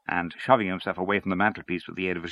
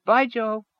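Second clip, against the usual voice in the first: sudden higher call after a departing friend; abrupt parting call; called out suddenly; 4 dB louder than usual. Acted. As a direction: toss a quick goodbye toward the door. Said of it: "called out suddenly".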